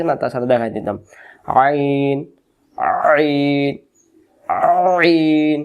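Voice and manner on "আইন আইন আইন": আইন squeezed from the middle of the throat, drawn out; pressed throat Ain. Shown as a demonstration. Teacher demonstrates the letter's articulation, throat-squeezed আইন. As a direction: press the throat hard on the first sound of আইন and stretch it. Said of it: "গলা চাপ দিবে না?".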